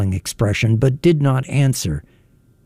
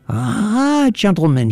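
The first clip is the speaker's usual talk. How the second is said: high-pitched piercing hail, only mildly loud; calling out; 9 semitones higher than usual. A narrator's reading. Intruder hails the gentlemen in the room, calling out high. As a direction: call out in a high, drawn voice. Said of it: "he called out loudly".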